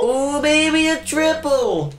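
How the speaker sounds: hype announcer. Pretend. Echoes the triple call.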